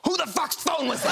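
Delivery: high, loud feigned outrage; indignant mock shout. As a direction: shout it to throw the blame on others. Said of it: "and I go".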